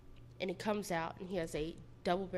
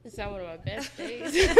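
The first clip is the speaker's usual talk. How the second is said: laughing; 8 dB louder than usual. Real, not acted. Laughs at own understatement.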